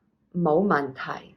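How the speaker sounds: 冇問題 said slowly, 冇 low rising, 問 low, 題 falling; slow tone demo. Shown as a demonstration. Teacher drills no problem for students, slow careful tones. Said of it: "low rising, low tone, down".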